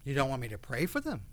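usual speaking voice

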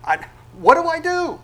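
high, loud, lost cry for help; a believer in trials crying out to God, high and panicked; panicked helplessness. Acted. Squeak it out in a panic. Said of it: "you're gonna go".

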